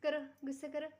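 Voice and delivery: very high, loud coaxing with giggles; baby talk. Acted. Mother urging Bulla to do it again, giggly high baby talk. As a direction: cheer the little one on through laughter.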